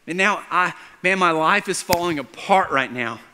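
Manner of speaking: loud, high, desperate questioning; anguished protest. Acted. Father whose daughter just died questions Jesus, anguished. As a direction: plead and protest with rising frustration. Grieving father's thoughts, acted out.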